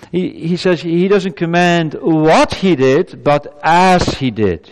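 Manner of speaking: "what he did" rising slowly, then "as" leaned on; stress on as. Real, not acted. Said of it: "not what I have done to you".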